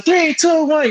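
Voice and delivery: high buzzer-beater countdown call; play-by-play countdown. Retold or imitated. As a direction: call the final seconds like an announcer. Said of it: "do my play-by-play".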